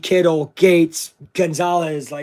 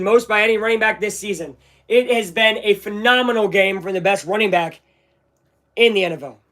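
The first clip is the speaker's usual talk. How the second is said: usual speaking voice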